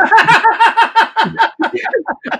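real laughter breaking out; laughing hard at the story; loud high laugh. Real, not acted. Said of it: "I started laughing even more".

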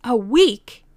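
loud, high incredulous echo; shocked disbelief. A narrator's reading. Old lady hears the length of delay, loudly incredulous. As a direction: repeat it back in dismay.

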